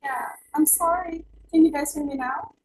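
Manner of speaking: normal talking voice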